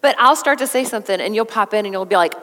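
normal talking voice

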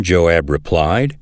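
usual speaking voice

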